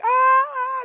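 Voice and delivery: high falsetto mock shrieks; imitated girls' screaming. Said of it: "I think they screaming".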